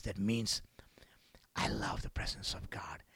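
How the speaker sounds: soft whisper. Acted. Preacher to congregation, whispering devotion. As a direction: whisper it tenderly. Preacher speaks of God's presence.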